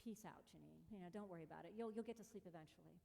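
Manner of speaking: telling herself to let go of the noise; calming self-talk. Retold or imitated. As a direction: hush yourself to sleep. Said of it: "I'm like".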